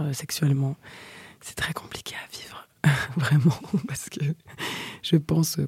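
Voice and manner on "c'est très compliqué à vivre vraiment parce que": airy whisper with a little laugh. Real, not acted. Whispering a confession to her listeners. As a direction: hush it like a secret confession.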